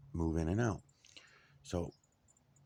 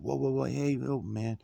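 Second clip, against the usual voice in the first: raised, higher "whoa whoa" to stop him; calming shout; 7 semitones higher, 4 dB louder than usual. Retold or imitated. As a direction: loudly cut him off before he yells. Heading off the landlord's yelling.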